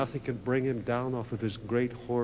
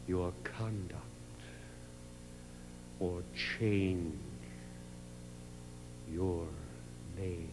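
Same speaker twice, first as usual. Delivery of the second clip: softer, lower, spaced-out words; preacher repeating the king's words gently; hushed echo; 4 semitones lower, 10 dB quieter, much slower than usual. Acted. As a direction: let each word fall quietly. Quiet final echo of command.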